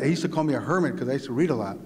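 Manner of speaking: ordinary talk, nothing marked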